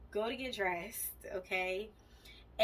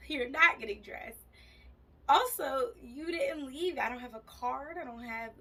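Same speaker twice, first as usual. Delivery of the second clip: exasperated scolding; 4 semitones higher, 3 dB louder than usual. Retold or imitated. Scolding her boyfriend for oversleeping.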